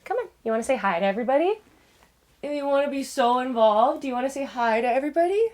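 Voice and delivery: playful sing-song for a pet; pet talk. Real, not acted. Talking to pet in baby voice.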